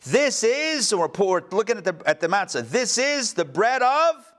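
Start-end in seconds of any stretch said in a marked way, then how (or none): 0.0-0.9 high-pitched, drawn-up proclamation; solemn opening pronouncement, pitched high; ceremonial declaration. Acted. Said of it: "and we say". 2.7-4.3 high, slow proclamation left hanging; stretched-out opening pronouncement, pitched high; ceremonial declaration. Acted. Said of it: "we declare opening".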